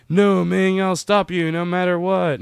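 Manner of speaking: high, insistent hero mimicry; mock heroic defiance. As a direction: imitate a single-minded hero, pitched right up. Mimics Flash's single-minded refrain.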